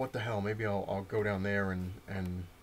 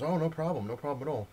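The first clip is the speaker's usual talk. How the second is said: high, bright-eyed eager to please; mock eager manager, higher and louder; eager placating; 6 semitones higher, 3 dB louder than usual. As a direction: fall over yourself to smooth it over. Manager placating angry customer.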